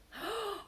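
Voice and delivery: delighted gasp. Acted. Peter finds radishes.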